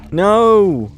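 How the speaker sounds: loud, high cry of alarm. Real, not acted. Player reacting to an enemy, alarmed shout. Enemy attack in horror game.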